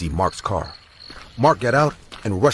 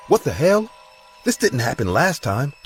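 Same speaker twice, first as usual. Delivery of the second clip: surprised; 7 semitones higher than usual. Acted. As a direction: jolt up in shock. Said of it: "She said in a surprised voice".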